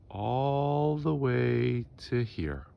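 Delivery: ALL THE WAY stretched long, rising high, then dropping on "here"; drawn-out emphasis. Real, not acted. Vlogger showing how big the woodpile was, drawn-out emphasis. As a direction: drag out "all the way" to show the distance.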